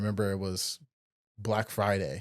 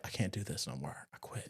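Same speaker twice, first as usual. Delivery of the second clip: much softer, lower broken voice; defeated quitting; 3 semitones lower, 13 dB quieter than usual. Retold or imitated. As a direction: let it die out, beaten down. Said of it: "he just came out like".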